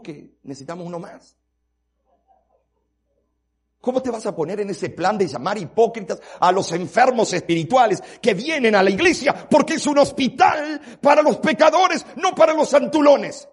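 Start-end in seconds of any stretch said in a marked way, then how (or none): 3.8-13.5 loud, high rebuke from the pulpit; fiery sermon rebuke; preaching at full force. Acted.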